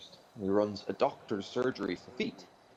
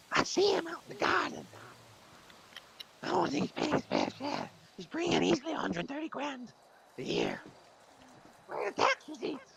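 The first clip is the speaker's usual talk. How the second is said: sad moth voice. Acted. Moth complaining to the foot doctor, very high and mournful. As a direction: wail like a heartbroken moth. Moth character in told joke.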